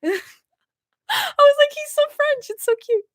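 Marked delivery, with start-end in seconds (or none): 1.7-3.0 high, softer squeal of adoration; gushing fangirl. Retold or imitated. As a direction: coo it like a smitten fan. Gushes over the French accent.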